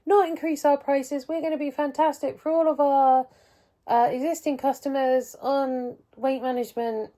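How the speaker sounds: sarcastic sing-song sales talk; mocking pharmacy voice. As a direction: parody the company's empty promises. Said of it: "Pharmacy T U went".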